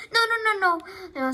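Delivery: high, loud string of refusals; goose's urgent protest. A narrator's reading. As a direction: stop him urgently. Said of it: "said the goose".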